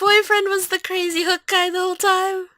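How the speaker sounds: excited question. Real, not acted. Excited reaction after the story.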